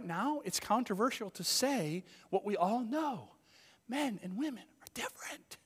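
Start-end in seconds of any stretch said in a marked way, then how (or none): 3.9-5.6 1 stage whisper. Real, not acted. Stage-whispered controversial line.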